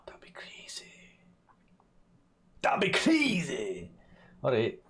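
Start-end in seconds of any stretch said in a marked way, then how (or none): 0.1-0.9 breathy, hushed whisper; mock-awed whisper. Streamer marvelling that beans could be all good, whispering. Same line whispered, then called out. 2.6-3.9 high, long drawn-out exclamation; exclaimed in mock amazement. Streamer marvelling aloud at all good beans, drawn-out amazement.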